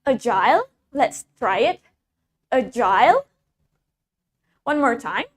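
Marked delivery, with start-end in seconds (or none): 0.1-0.7 "agile" with a full long-i ending, like "aisle"; British pronunciation of agile. Shown as a demonstration. Teacher modelling British "agile" with its long-i ending. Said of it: "In British accent, we pronounce it as". 2.5-3.3 "agile" rhyming its ending with "aisle"; a-JILE, lifted at the end; British pronunciation of agile. Shown as a demonstration. Teacher leading practice of British "agile", long-i ending. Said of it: "In British accent".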